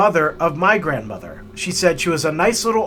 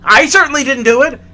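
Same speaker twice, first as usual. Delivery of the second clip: much higher, loud accusing snap; angry retort; 9 semitones higher, 7 dB louder than usual. Retold or imitated. Grandfather accusing his wife about the light, loud angry snap. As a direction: fire back at her in disbelief. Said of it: "He goes".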